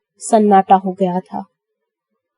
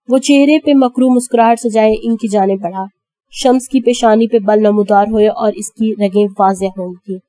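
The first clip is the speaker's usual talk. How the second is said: plain reading voice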